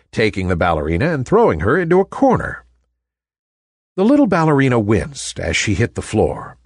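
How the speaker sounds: ordinary delivery, nothing marked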